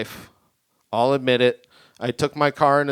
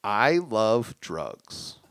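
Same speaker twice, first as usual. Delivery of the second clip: slow deadpan confession; 2 semitones lower, much slower than usual. Acted.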